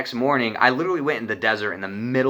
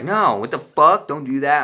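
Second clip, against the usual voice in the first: higher, louder alarmed objection; upset protest; 4 semitones higher, 5 dB louder than usual. Retold or imitated. Baby's mom objecting to him leaving, higher and louder alarmed protest. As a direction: snap back in dismay.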